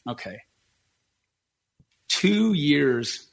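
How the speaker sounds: ordinary talk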